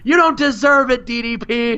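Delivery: high-pitched mock indignant retort; acted retort. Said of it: "Eddie is like".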